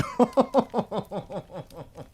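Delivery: a long, high, breathy giggle; laughing at the rude pun. Real, not acted.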